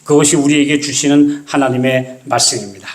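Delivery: ordinary delivery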